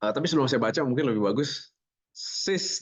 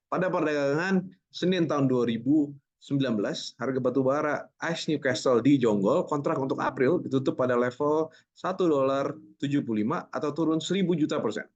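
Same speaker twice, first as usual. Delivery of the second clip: steady, authoritative newsreader delivery; polished anchor voice selling wrong facts; confident news read; 4 semitones higher than usual. Shown as a demonstration. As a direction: read the nonsense with total assurance. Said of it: "Ini saya baca salah pun, orang masih percaya".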